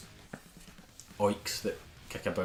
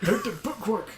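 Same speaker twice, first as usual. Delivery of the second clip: high, loud mock idiot voice; clueless non-reader; 11 semitones higher, 4 dB louder, slower than usual. Joining in the joke about non-readers, high clueless voice. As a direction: act totally baffled by books.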